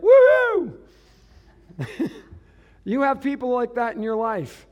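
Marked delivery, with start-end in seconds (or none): 0.0-0.8 very high, loud woo-hoo; mock cheering. Crowd suddenly cheering when Jesus calls, mock whoop. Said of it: "And they're like".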